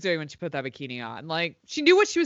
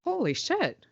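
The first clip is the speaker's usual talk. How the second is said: shocked; 11 semitones higher, 4 dB quieter, slower than usual. Real, not acted. Shocked reaction.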